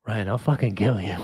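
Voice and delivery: low, mock-menacing threat. Joking to Ryan over the Dallas game, low mock menace. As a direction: pretend to be furious, half-laughing.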